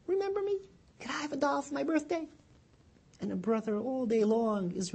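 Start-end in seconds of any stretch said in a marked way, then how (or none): 0.1-2.3 put-on high, hopeful childlike plea; little girl's prayer. Little girl asking God for a birthday doll, high. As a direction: ask God sweetly like a small child. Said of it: "she turns to God again".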